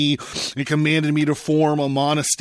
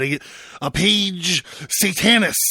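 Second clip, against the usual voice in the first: higher-pitched cry of horror; horrified exclamation; 5 semitones higher than usual. A narrator's reading. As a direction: exclaim it aghast at what stands before you. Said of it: "Ammonius exclaims, horrified".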